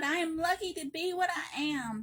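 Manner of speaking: ordinary delivery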